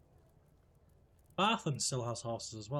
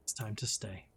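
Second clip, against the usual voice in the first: tender. Acted. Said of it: "And I'm like".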